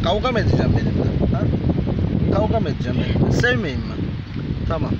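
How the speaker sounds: loud, high coaxing voice for animals; high excited pet talk. Acted. Talking to street cats, loud high excited coaxing. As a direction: call them in eagerly, loud and high.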